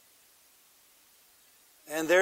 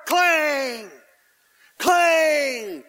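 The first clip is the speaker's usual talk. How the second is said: metal clang; 8 semitones higher, 5 dB louder than usual. Acted. Hammer blows on the nails.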